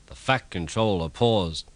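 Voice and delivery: usual speaking voice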